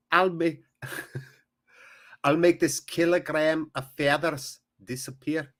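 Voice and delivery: hammed-up amateur Scottish accent; badly faked Scottish burr; attempted Scottish accent. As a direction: have a go at a Scottish accent and nearly laugh. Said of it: "I can't do Scottish".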